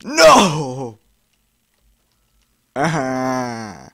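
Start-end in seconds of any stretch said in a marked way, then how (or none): 0.0-1.0 loud frustrated No!; frustrated shout. Real, not acted. Gamer shouting at the screen, real frustrated yell. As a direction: yell it as you die again. Gamer fails the level again.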